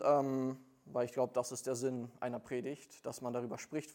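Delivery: ordinary talk, nothing marked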